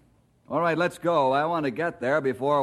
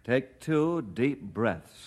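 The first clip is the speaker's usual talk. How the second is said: steady him with measured words; calming instruction; 3 dB quieter, much slower than usual. Acted. Calming a worked-up caller.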